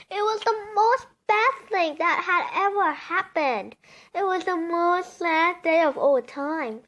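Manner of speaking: dramatic. A narrator's reading. Storyteller telling children of the cross, loud and dramatic. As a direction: say it big, like the worst news ever.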